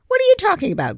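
snapping. Acted. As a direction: bite the question off hard. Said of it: "Griffin snapped".

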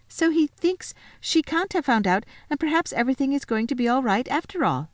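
put-on high, bright little girl voice; Lucy, happy and excited. Lucy telling Edmund about her lunch, high and excited. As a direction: chatter eagerly like a glad child.

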